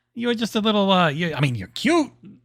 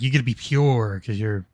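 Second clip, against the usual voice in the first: lower mock lecturing; 9 semitones lower, 4 dB quieter than usual. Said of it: "gonna be like".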